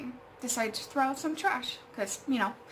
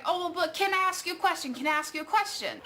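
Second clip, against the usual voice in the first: higher, hurried imitation of a pushy man; mock pestering; 7 semitones higher, faster than usual. Man keeps asking the woman a question, mock pestering. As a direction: lightly mimic someone badgering with a question.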